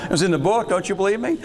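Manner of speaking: high laughing tease, then a squealing laugh. Real, not acted. Teasing the class about the Roman emperors, laughing. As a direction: laugh through the question, teasing. Jokes about Bush and Cheney.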